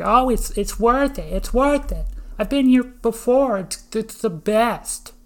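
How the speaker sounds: high, loud voice of people in line; gushing bystanders. Retold or imitated. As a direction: play the overeager regulars, high and loud. Acting insistent people in the line.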